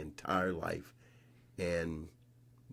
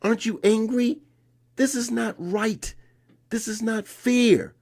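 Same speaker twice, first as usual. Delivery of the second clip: high, loud, outraged pleading; indignant young self; 13 semitones higher, 7 dB louder than usual. Retold or imitated. Boy begging Ashe to object to the tournament director, outraged. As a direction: push him to protest the unfair ruling. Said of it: "I'm going".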